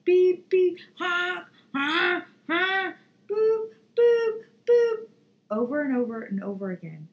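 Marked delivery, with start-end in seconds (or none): 0.0-5.1 high, loud mimicked MRI beeps and honks; imitated machine noise. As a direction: blare it out like a scanner alarm. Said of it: "the thing is".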